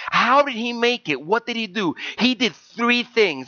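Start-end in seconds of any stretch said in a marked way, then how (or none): none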